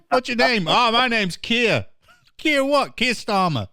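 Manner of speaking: high, playful mock exchange between two voices; a high-pitched comic back-and-forth; mock questioning. Acts out asking an MP's name.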